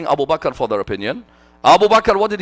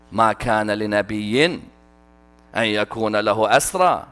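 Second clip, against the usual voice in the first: rapid recited Arabic, lower; quick Quran recitation; 6 semitones lower, much faster than usual. Acted. Preacher reciting the ayah as proof, fast recitation. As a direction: rattle off the verse in recitation.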